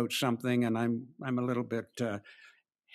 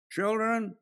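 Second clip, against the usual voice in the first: calling out; 9 semitones higher than usual. Acted. Jesus calls from the shore.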